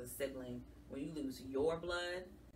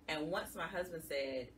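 ordinary delivery

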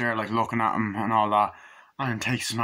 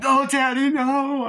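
put-on high, louder frightened child's cry; mock scream; 6 dB louder, slower than usual. Reviewer voices the daughter crying for her dad, high and loud. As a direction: wail it like a scared little girl.

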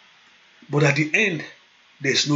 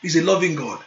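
usual speaking voice

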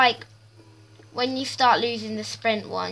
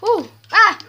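startled gasp and yelp; 10 semitones higher, 11 dB louder than usual. Real, not acted. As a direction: jump at a sudden scare. That just scared me.

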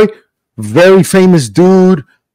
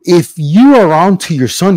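usual speaking voice

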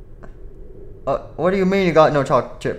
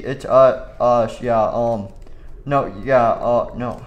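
ordinary delivery

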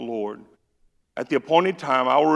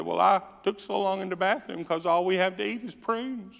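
raised-pitch whine; whining mama; 7 semitones higher than usual.

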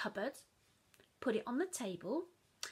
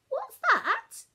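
much higher, louder Mum; Mum sharp question; 14 dB louder than usual. Acted. Mum spotting chocolate on child's chin, sharp. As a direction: pounce on the clue.

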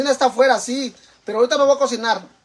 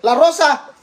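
loud, high call to someone; 7 semitones higher, 7 dB louder, slower than usual. Real, not acted. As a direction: call her name across the yard. Calling someone by name.

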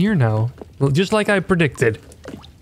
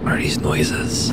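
whisper; 12 semitones lower than usual. Real, not acted. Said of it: "starting to become a little bit creepy".

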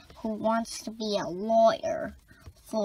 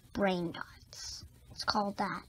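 whisper; 3 semitones lower, 9 dB quieter than usual. Acted. Secretive explanation of mind powers.

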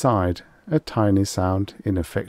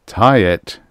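loud flat command; inexorable; 8 dB louder than usual. Acted. Extroni orders Lin, a loud unyielding command. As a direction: give the order with no give. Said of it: "Extroni said inexorably".

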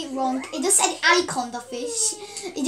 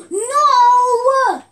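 loud, higher cry of no; despairing yell; anguished shout; 8 semitones higher, 11 dB louder than usual. Real, not acted. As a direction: shout as the pet dies. Animal dying.

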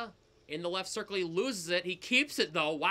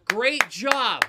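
sarcastic praise; 2 semitones higher, 8 dB louder than usual. Real, not acted. Sarcastic praise after player's dive.

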